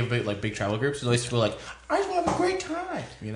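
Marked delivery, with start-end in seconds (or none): 1.9-3.0 squeaky imitating voice, much higher; high mock voice. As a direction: pitch way up in a mock whine. Imitating travel group member.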